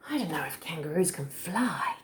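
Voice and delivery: soft, doubtful whisper; whispered aside. Real, not acted. Reader confiding to child about flying kangaroos, whispered.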